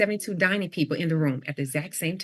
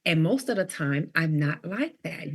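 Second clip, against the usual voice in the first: ordinary talk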